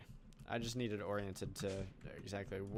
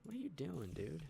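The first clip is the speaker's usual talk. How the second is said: exasperated surprise; 6 semitones higher, 4 dB quieter than usual. Real, not acted. Exasperated at a game creature.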